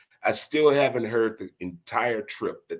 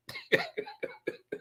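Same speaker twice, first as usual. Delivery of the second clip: breathy high laugh; 8 dB quieter than usual. Real, not acted. Laughing after thanking dad.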